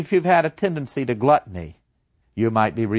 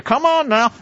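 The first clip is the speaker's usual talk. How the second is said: higher, louder, insistent coaxing; conscience dragging; 12 semitones higher, 6 dB louder than usual. Acted. Conscience hauling the reluctant soul down, higher and louder. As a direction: pull the soul along, firmly.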